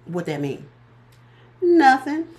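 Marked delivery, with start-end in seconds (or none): 1.6-2.3 emphatic. Real, not acted. Emphatic punchline.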